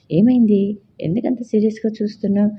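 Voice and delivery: puzzled. A narrator's reading. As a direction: caught off guard, asking why she glares.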